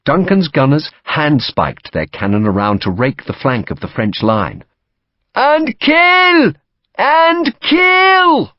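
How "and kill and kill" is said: very high, loud, drawn-out yell; top-of-voice shouting. A narrator's reading. Ensign charging with the regiment, shouting his lungs out. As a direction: scream it out at full voice. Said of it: "shouting at the top of his voice".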